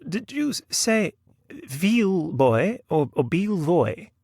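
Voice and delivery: high, halting novelist's query; puzzled lady novelist. Acted. Novelist asks about the name, high puzzled voice. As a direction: sound out the strange name in a high lady's voice.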